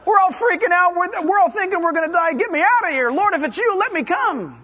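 high, loud panicky Peter begging to get out; shouting Peter, high and loud with fear; frantic Peter. Retold or imitated. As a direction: play a terrified disciple yelling from the boat. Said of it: "he's saying".

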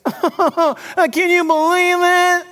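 mock whining impression of the Pharisees; mocking whiny Pharisee. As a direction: whine it like a smug religious man griping. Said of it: "they're like this".

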